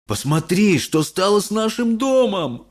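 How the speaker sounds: father pointing at ruins; dismayed exclaim. A narrator's reading. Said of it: "воскликнул мистер Бакет". (отец в отчаянии восклицает)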